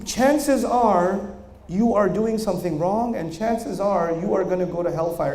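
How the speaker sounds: ordinary talk, nothing marked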